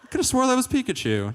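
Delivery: high, loud squawk of disbelief; incredulous kid. Acted. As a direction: protest the wrong answer in outrage. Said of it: "and you're like".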